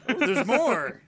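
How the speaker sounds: high, loud surprised exclamation. Real, not acted. Surprised more text remains.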